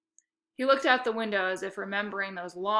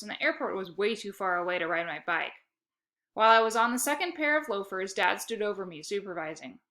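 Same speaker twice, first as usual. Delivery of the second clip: usual speaking voice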